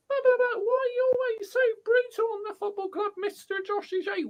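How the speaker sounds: high, pleading mock fan voice; whiny fan voice. Imitating a fan defending the manager, high and whiny. Voices imagined critic of his rants.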